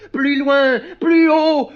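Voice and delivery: high, loud calling out; exalted cry. Acted. Clown urging his springboard upward, exalted shout. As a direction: cry out toward the sky. Clown crying to soar higher.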